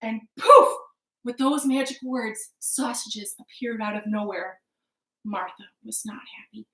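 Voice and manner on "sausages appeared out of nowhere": usual speaking voice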